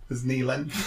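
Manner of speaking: put-on Geordie accent, laughing through it. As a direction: say knee length the Geordie way. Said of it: "in a Geordie accent".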